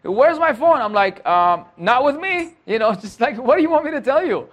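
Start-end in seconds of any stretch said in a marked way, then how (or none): 0.0-0.8 high-pitched mock demand; raised-pitch imitation of an online beggar; demanding commenter. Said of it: "surely all the comments will be". 1.8-2.5 high-pitched, mock-innocent sarcasm; playful sarcastic comeback, pitched high; sarcastic reply. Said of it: "I always reply with my sarcastic reply". 3.4-4.5 high-pitched sarcastic comeback; playful sarcasm in a raised voice; sarcastic reply. Retold or imitated. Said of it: "I always reply with my sarcastic reply".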